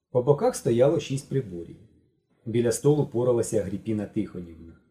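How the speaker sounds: usual speaking voice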